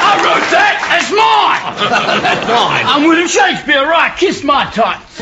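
high, shouted tipsy boasting; drunken Shakespeare. Acted. Drunk poet claiming his lines on the doorstep, high boastful shout. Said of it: "after a couple of beers".

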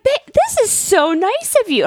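lightly voiced grateful husband; mock-sweet thank you.

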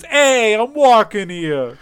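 loud, high, drawn-out Brooklyn holler; hammed-up "New Yorker in traffic" Brooklyn accent; attempted Brooklyn accent. Shown as a demonstration. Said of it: "can you do a Brooklyn accent?".

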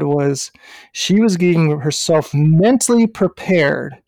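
usual speaking voice

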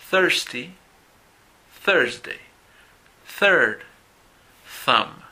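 "thirsty" and "Thursday" open on a pure puff of air through the teeth; voiceless th, only air. Shown as a demonstration. Teacher ends the voiceless drill for the class to repeat, clear. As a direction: make the th in "third" only air, no voice. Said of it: "el sonido fue solamente aire".

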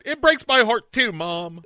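high, mock-tearful whine; a daughter whining back at her mom; whiny daughter.

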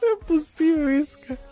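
high, laughing, can't believe it; laughing disbelief. Real, not acted. Laughing at the chat.

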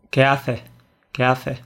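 "¿Qué haces?" with no final s, said as "qué hace"; Andalusian dropped s. Shown as a demonstration. Showing a friend how Andalusians say it, s swallowed. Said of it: "en lugar de".

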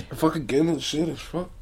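throat burning after a shot; strained, quiet voice with a burning throat; after a shot of liquor. Real, not acted. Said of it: "My throat's on fire".

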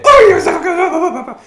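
mock the owner who gushes over the dog; gushing overexcited praise. Owners overpraising the dog after a hold, hammed-up gush. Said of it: "folks do this".

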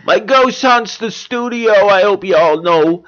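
high, drawn-out goofball comedian voice; exaggerated high comic impression; Jerry Lewis. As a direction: ham it up as an old slapstick star. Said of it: "Jerry Lewis is back".

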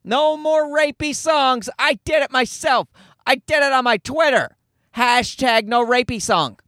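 preen like you fixed the world with a tweet; imitating a smug online activist. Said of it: "smugly folding their arms going".

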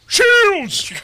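very high, loud bridge-officer shout; Sulu. Acted. Co-host mocked as Sulu plays along, high shouted impression. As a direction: yell it out at full pitch. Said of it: "You sound like Sulu".

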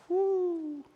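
long, high, soft "woo"; soft high whoo. Real, not acted. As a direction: exhale a high, quiet whoo at the hard saying. Reacts to a hard saying.

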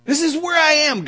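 high, loud cry of distress; anguished cry. Acted. Preacher acting out the psalm's meaning, anguished cry. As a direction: cry it out at full voice. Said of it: "This is what this means".

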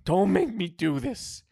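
high, loud plea; desperate high-pitched begging. A narrator's reading. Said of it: "he begged".

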